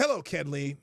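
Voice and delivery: ordinary talk, nothing marked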